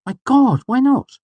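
high, loud disbelief; aghast. A narrator's reading. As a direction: exclaim it as a shocked friend. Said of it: "Simon gaped".

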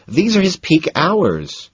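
high and slow, fed up; exasperated. A narrator's reading. Elizabeth explains her dealer's hours, exasperated. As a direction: lay out the obvious, exasperated.

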